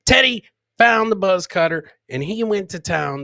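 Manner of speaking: ordinary talk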